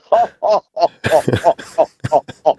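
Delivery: hammed-up man laughing; imitated man's belly laugh. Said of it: "a nonstop man laugh where he just".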